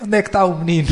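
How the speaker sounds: protective mother. Retold or imitated. Mother confronting the school over her bullied son, loud and demanding. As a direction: storm into the school demanding answers. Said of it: "a minha mãe vai lá no dia seguinte".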